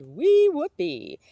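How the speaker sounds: high, loud, stretched-out whoop of joy; drawn-out elated cry; gleeful whoop. A narrator's reading. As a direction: let it fly like a happy old man skipping. Old man skipping in rain.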